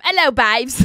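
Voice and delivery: loud, hammed-up British greeting; British accent. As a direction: belt out a mock UK accent. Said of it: "I'm speaking".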